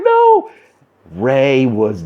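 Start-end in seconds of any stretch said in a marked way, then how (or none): 0.0-0.5 high, wailing mock-cry; exaggerated tearful squeal; mock bawling. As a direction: wail it like an over-the-top sob. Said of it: "I was, like, bawling".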